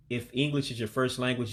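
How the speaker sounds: ordinary talk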